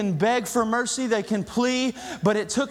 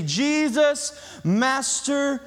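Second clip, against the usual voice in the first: higher-pitched calling out to Jesus; desperate plea; 5 semitones higher, much slower than usual. Acted. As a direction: cry out for help, pitch lifted. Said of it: "it was a desperate plea".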